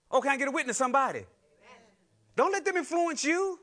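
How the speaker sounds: loud, high, driving preacher call; fired-up pulpit exhortation; full-force preaching. Acted. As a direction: rouse the congregation.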